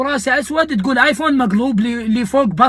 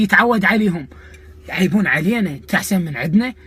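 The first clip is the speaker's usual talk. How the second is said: usual speaking voice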